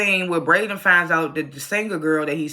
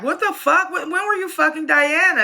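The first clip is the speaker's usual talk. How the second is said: much higher, shocked mock yelp; stunned outburst; 11 semitones higher, 4 dB louder than usual. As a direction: act out a big hammed-up double take. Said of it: "And Brayden's like".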